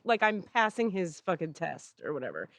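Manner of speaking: ordinary talk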